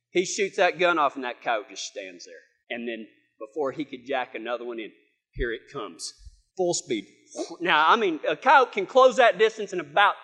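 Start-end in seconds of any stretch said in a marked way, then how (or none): 1.1-7.1 very low, soft storytelling; hushed suspense. Retold or imitated. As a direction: drop your voice as danger approaches. Suspense as coyote charges.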